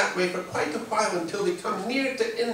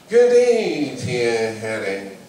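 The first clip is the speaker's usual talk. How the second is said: sly fox; 5 semitones lower, 6 dB louder, much slower than usual. Acted. The fox greets Harry slyly.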